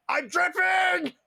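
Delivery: high, slow screamed Irish brag; Irish accent shout. Retold or imitated. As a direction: scream it like a cocky Irish fighter. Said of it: "doing a fake Irish accent".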